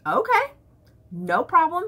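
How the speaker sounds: high-pitched mock-agreeable comeback. Wife retelling her answer to husband's complaint, mock agreeable. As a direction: say it way up high, as if fine with it.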